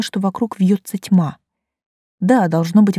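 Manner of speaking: plain reading voice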